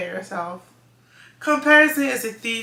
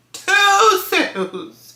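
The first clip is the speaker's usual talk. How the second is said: much higher, very slow, laughing in disbelief; incredulous laughing echo; 9 semitones higher, 4 dB louder, much slower than usual. Real, not acted. Designer laughing at her tiny first month of sales, incredulous. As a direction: stretch it out, laughing at how few. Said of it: "the first month we had two sales".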